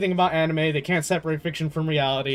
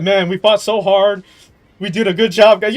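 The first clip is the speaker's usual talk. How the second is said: pat yourself on the back, hammed up; mock proud boycotter; 3 semitones higher, 8 dB louder than usual. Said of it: "And they said".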